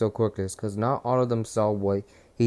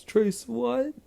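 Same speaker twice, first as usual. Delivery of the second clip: much higher puzzled voice; high confused bully; 12 semitones higher than usual. Retold or imitated. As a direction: squeak it out, baffled. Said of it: "And Monoma says".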